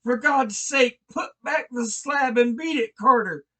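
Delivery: high, loud frantic yell up from below; desperate high shout from the vault. Acted. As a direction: yell at him to flee in panic. Warren yelling up from the tomb.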